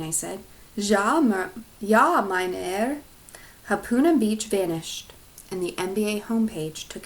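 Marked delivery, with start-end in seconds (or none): 0.7-3.0 German computer voice. Acted.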